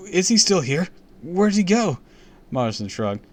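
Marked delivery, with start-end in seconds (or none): 0.1-2.0 high, loud urgent question; desperate to find him; urgent cry. Acted.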